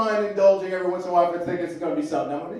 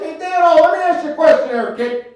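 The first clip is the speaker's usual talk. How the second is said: much higher, louder cranky uncle; Uncle Mike voice; 10 semitones higher, 8 dB louder than usual. Retold or imitated. Uncle calling his nephew from the toilet, loud high voice. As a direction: belt it out like the old man on the phone. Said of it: "My Uncle Mike calls".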